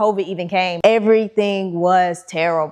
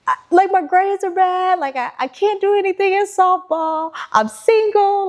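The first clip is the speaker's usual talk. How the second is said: much higher, pouring it out tearfully; tearful venting; 11 semitones higher than usual. Retold or imitated. Daughter crying to her dad about everything, much higher. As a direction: blurt out every worry through tears.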